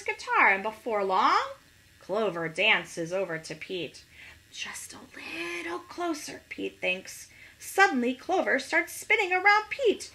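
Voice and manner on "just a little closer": whispered, higher, conspiratorial; sneaky whisper. Acted. Pete thinking to himself as Clover dances closer, whispered. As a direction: hush it like you're luring him in.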